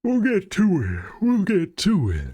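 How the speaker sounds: very high, swooping down at the end; playful high voice. Pretend. Animator puts off a task, in playful high voice. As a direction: swoop from high pitch, drawing it out. Sing-song repeated phrase, far higher.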